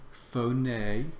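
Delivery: Phone said as foh-NAY, two syllables, stress on the last; Greek pronunciation. Shown as a demonstration. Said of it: "Notice where the accent is".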